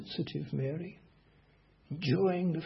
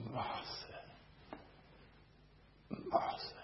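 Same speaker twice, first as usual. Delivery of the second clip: hushed, loving whisper of the name; tender whisper; 2 semitones lower, 13 dB quieter, slower than usual. Shown as a demonstration. Preacher voicing Jesus to Martha, a tender whisper. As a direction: breathe the name gently, full of care. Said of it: "in order to express care and love".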